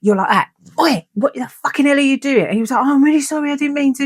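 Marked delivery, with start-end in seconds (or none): 0.8-2.4 high, indignant telling-off; retold pissed-off outburst. Woman elbowed in the eye by sleeping partner, acting pissed off. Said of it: "that really, really, really pissed me off". 2.8-4.0 high-pitched mock apologetic voice; imitated apology. Imitating sleepy partner after the elbow, high apology.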